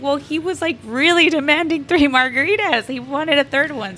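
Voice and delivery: high-pitched retold excuse; defensive waiter. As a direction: imitate a flustered waiter justifying himself. Waiter's retold defence to upset boss.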